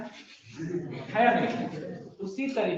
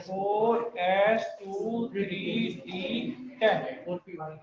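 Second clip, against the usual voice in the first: slow dictation; much slower than usual. Shown as a demonstration. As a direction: spell each term out very slowly. Dictating configuration to students slowly. (धीरे-धीरे लिखवाना)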